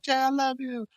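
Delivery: high squeaky mock baby. Host mimics babies cheering for Jey, squeaky baby voice. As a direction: squeal it like a tiny fan. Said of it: "There's babies there. They're like".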